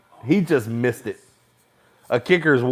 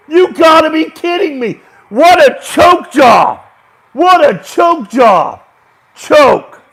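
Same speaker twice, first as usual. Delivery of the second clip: slow, high, loud jeering shout; outraged shout; 14 semitones higher, 10 dB louder, slower than usual. Real, not acted. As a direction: drag out the scorn at the kicker. Fan outraged at missed kick.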